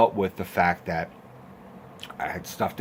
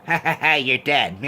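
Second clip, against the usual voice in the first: taunting killer doll; 5 semitones higher, 3 dB louder than usual. Acted. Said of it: "he squirts the gun in his face".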